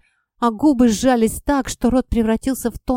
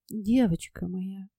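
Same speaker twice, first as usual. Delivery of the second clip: softer and slow, a little unsteady; trembling softly; 2 semitones lower, 7 dB quieter, slower than usual. A narrator's reading. Mother nervously addressing her crying daughter, soft and slow. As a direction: gently, voice wavering a bit. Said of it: "Голос мамы немного дрожал".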